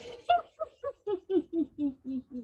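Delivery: high he-he exercise laugh. Shown as a demonstration. Instructor demonstrating the third laughter sound, high he he. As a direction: let a high he he he out. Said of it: "and laugh out".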